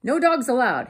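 loud, high-pitched warning; cross storybook cactus yelling; grumpy yell. A narrator's reading. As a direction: bellow a rule at an intruder. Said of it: "Hank yelled".

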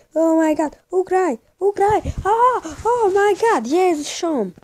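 high panicked yelling; frantic shouting. Real, not acted.

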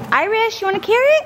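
high, sing-song offer to a horse; pet talk. Real, not acted. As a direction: coax the horse with a carrot. Talking to the horse.

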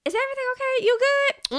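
high, sweet, prying check-in voice; imitating a friend prodding whether everything is okay; concerned asker. Voicing someone checking on you.

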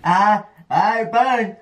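high, laughing through the burn; giggling while the mouth burns; pained laughter. Real, not acted.

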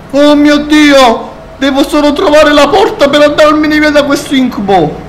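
high frightened pleading; desperate to get out; scared. Real, not acted. Scared in horror game.

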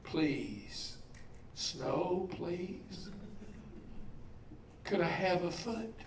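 soft, pleading plea; a boy kneeling and pleading quietly for snow; begging on his knees. Retold or imitated. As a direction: beg under your breath, humbled. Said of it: "it's got him on his knees".

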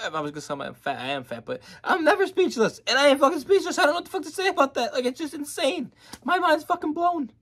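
can't contain the thrill; overexcited. Real, not acted. Overwhelmed after replaying the clip.

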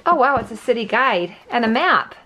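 ordinary talk

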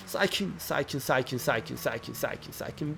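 soft, repeated calming murmur; hushed coaxing. Real, not acted. Gamer coaxing his sliding car to behave, hushed.

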